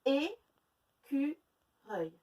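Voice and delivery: Écureuil very slowly, each syllable apart. Shown as a demonstration. Teacher showing pupils the three syllables, very slow. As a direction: pull Écureuil apart beat by beat. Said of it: "C'est un mot avec trois syllabes".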